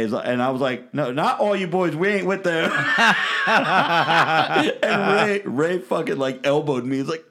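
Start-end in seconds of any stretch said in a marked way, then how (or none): 0.9-2.7 retold outburst, higher and a little louder; raised-pitch emphatic denial, acted out; loud retort. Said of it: "you said that loud as hell". 2.8-4.8 real burst of loud, high laughter; big rolling laugh. Real, not acted. Laughing at his own punchline.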